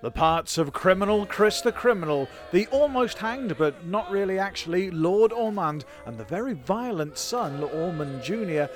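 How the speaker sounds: usual speaking voice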